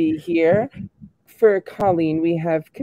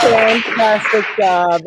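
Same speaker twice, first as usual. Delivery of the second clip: slow, louder gushing praise; enthusiastic praise; 4 dB louder, slower than usual. Real, not acted. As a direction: cheer the performer on. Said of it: "What an incredible performance".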